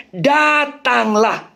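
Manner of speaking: slow, drawn-out "Datanglah"; emphasis on come. Shown as a demonstration. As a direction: draw "Datanglah" out syllable by syllable. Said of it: "Bukan penekanannya".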